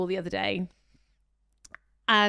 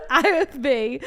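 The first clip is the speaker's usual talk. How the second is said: laughing; 4 dB louder, slower than usual. Real, not acted. Laughing while telling.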